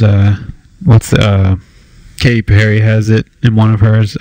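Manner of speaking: normal talking voice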